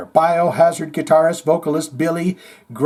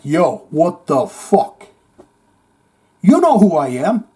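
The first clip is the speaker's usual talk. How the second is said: slow indignant confrontation. Retold or imitated. Confronting Lars in VIP.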